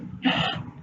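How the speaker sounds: sharp frightened gasp. A narrator's reading. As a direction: catch your breath in fright. Frightened boy hears bear.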